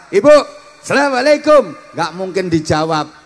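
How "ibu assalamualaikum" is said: much higher and louder salam. Shown as a demonstration. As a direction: fling the greeting up high.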